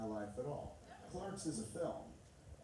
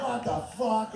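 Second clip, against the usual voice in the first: much higher, loud mock disbelief; shocked reaction; 11 semitones higher, 14 dB louder, slower than usual. Friend hearing a new sex term, loud mock shock. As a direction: blurt it out, scandalized.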